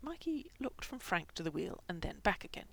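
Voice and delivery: plain reading voice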